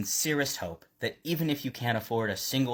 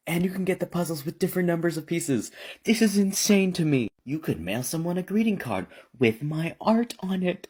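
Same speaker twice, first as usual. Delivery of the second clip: gushing excitement; 6 semitones higher than usual. Real, not acted. Gushing over products.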